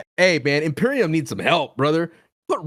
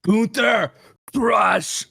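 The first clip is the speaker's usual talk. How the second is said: Lana impression; 9 semitones higher, much slower than usual. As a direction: shout it like a ringside valet. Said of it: "Lana ... retaps into Gunther's psyche".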